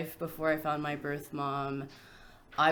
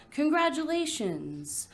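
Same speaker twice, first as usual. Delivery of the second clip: higher-pitched celebratory lilt; mock congratulations; 10 semitones higher than usual. Poet voicing the shower guest who never came, lilting congratulations. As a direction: lift it like a party guest's congratulations. Imagined guest's quote in poem.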